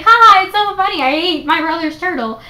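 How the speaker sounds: hammed-up carefree three-year-old; gleeful little kid. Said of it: "He's like".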